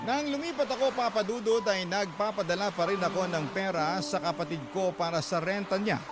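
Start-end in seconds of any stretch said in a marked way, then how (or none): none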